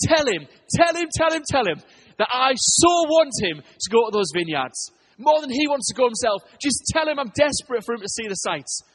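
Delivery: impassioned, emphatic urging; urgent pleading. Retold or imitated. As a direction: press the message home with rising urgency. Voices God urging the man.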